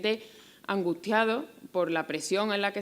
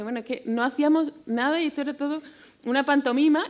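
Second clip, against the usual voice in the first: higher, louder, fast and amused, tossed off like nothing mattered; mock shrugging quote; 8 semitones higher, 6 dB louder, faster than usual. Voicing the author dismissing it all, amused and higher. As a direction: laugh it off as if it were all a joke.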